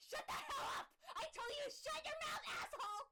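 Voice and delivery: high, raw, hostile scream; furious screaming outburst. Acted. Rena screaming at Keiichi to shut up, raw furious shriek. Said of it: "Rena's raw, hostile emotion".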